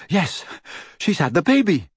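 high, elated confirmation; shouted joy. A narrator's reading. Lupin confirming the birth to his friends, mild joyful shout. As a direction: cry it out high, without much volume. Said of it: "shouted Lupin".

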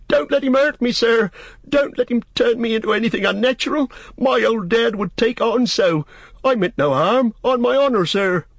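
high, loud panicked pleading; quaking with fear. A narrator's reading. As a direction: beg for protection in a very high voice.

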